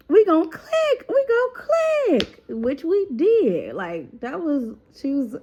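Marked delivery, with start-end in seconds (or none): 0.1-2.4 excited sing-song. Retold or imitated. Excited retold greeting, sing-song.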